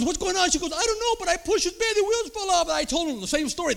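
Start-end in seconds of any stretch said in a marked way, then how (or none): 0.0-2.7 high, alarmed mock nurse voice; flustered nurse. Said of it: "the nurse says".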